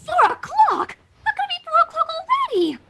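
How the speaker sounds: high panicked disbelief; gasping shock. Acted. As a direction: realize you're late, horrified. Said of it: "Rarity gasped".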